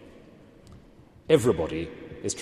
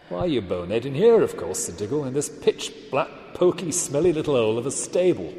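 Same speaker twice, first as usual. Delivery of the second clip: scornful dwarf; 6 semitones higher, 3 dB louder than usual. Acted. Diggle insults the children.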